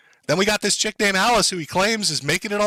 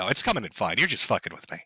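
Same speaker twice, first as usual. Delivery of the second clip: ordinary talk, nothing marked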